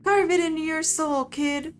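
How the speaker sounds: hammed-up Southern tough talk; Southern accent, tough. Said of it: "a southern accent".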